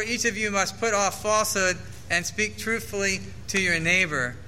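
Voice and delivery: ordinary delivery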